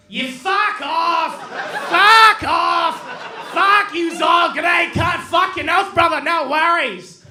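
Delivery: high, very loud Australian squawking; Australian bird. Acted. Bird in Australia cursing passers-by, shouted Australian accent. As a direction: shriek it in broad Aussie, words stretched out. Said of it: "this is what an Australian bird sounds like".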